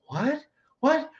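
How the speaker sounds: high, questioning, imitated bafflement; mock disbelief. Girlfriend hearing the rent is unpaid, mock disbelief. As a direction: play her taken aback.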